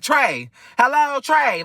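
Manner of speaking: high, slow, drawn-out "hello", stretching "Trey"; mimicking her call. Storyteller mimicking how the friend greeted him on the phone, slow and high. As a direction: drag out the greeting, pitched high and loud. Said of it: "she ain't saying it like this".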